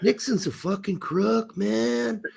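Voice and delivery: lazily stretched hippie speech. Acted. Mimicking hippie-era talk, spaced-out hippie complaint. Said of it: "people started talking like".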